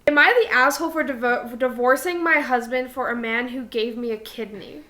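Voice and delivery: ordinary delivery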